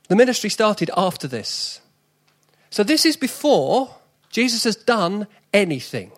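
loud, building sermon list; preaching at full force. Acted. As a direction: hammer each "No" home from the pulpit. Listing with rising fervour.

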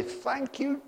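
high, lifted voice praising Father in thanks; joyful morning prayer. Shown as a demonstration. Believer thanking the Father each morning, high joyful prayer. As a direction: pray it out high and glad, like waking up thankful. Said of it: "you wake up every morning and say".

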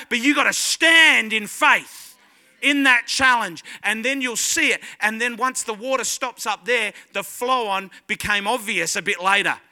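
loud, driving sermon delivery with punched key words; impassioned preaching. Real, not acted. Preacher urging congregation to stand in faith, full-force preaching. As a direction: build into forceful, urgent exhortation.

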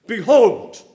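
Behold called out loud, falling at the end; Behold, declared to the congregation; proclaimed behold. Shown as a demonstration. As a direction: ring out Behold like a summons. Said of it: "whenever that word is found".

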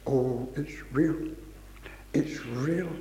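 low, soft, slow realisation; hushed chorus recitation. Retold or imitated. Preacher recalls first playing chorus at piano, hushed recitation. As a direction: say it softly, as if just discovering it. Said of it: "and I started to say".

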